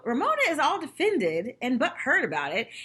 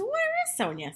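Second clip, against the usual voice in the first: much higher, probing ask; pointed question. Retold or imitated. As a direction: pitch it up like a gotcha. Luann asks about absent Sonja.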